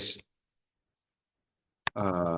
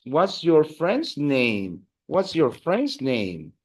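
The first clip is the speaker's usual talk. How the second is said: slow model phrase; 3 semitones higher, slower than usual. Shown as a demonstration. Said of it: "what if I wanted to ask".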